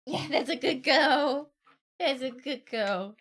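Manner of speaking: slow, tender praise for a pet; praising the dog. Real, not acted. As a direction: coo praise slowly.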